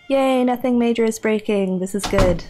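ordinary talk

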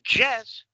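wrong YES, sounding like the name Jess; hard J mistake. Shown as a demonstration. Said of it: "Cuando tú dices yes".

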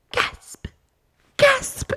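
hammed-up shocked gasps; mock gasp. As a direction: gasp dramatically like a shocked wife. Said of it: "she's like".

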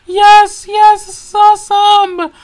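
loud excited yes yes; joyful cheer. Acted. Mario cheering his license, loud joy. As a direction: pump the fist with your voice. Celebrating result.

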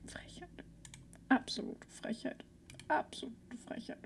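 unvoiced mock-offended whisper; whispered indignation. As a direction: hiss it under your breath. Mutters to herself indignantly. (geflüsterte gespielte Empörung)

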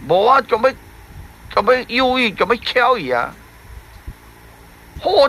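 lightly voiced sorrowful fish; grieving fish. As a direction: moan about your sorrow as the fish. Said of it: "三角鱼讲".

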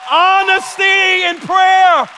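loud, slow, high proclamation; preacher calling out the key point; emphatic shout. Real, not acted. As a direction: hammer the words out slowly. Preacher's climactic declaration.

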